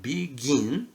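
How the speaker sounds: "begin" with a drawn-out GIN; stress on second syllable. Shown as a demonstration. Teacher giving a spelling example, "begin" stressed on GIN. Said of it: "the stress is on the second syllable".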